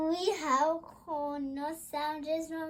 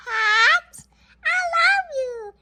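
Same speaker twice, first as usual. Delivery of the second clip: high, loud, cheery toy voice; 10 semitones higher, 9 dB louder than usual. Pretend. A child playing her toys, high and cheery. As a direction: play a tiny happy toy character.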